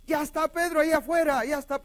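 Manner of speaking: high, loud, rushed cry; acting an excited girl calling to everyone; excited announcement. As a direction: burst in with breathless news, acted out. Acts Rhodes announcing Pedro excitedly.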